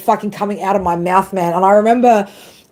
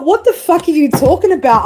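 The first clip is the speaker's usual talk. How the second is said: incredulous retort; 8 semitones higher than usual. Retold or imitated. Retorting to her ego.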